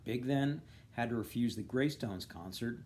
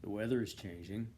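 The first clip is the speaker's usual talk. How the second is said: plain reading voice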